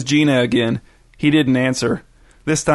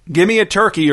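higher, restrained narrator's shout; pitched-up, moderately forceful demand; 6 semitones higher than usual. A narrator's reading. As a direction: demand it in a mild raised voice. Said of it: "Irvin shouted".

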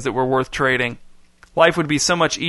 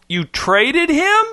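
slow, climbing to a very high squeak; rising squeak; 13 semitones higher, much slower than usual. A narrator's reading. Boy learns his puppy was traded, voice rising to squeak. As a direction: draw the question out, soaring up in disbelief. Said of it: "his voice rising to a squeak".

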